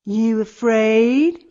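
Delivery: loud, slow dare; taunting bully. A narrator's reading. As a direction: stretch out the taunt, loudly. Bully taunts small girl in story.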